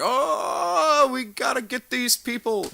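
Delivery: high, loud hammed-up zealot voice; mock witch-hunter. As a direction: squeal it like a frantic witch-hunting colonist. Mocking Salem witch-hunters.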